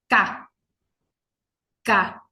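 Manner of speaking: Ka said with no throat vibration; crisp unvoiced Ka from the back; k sound demo. Shown as a demonstration. Teacher showing ka does not vibrate. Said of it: "Fíjense que no vibra".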